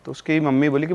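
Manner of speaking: ordinary talk, nothing marked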